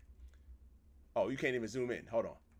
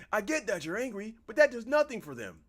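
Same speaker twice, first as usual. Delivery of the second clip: high, raised character voice; acted pleading spouse; 8 semitones higher, 6 dB louder than usual. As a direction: argue back in a much higher voice. Redoes line in other character's voice.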